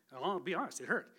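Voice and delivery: ordinary talk, nothing marked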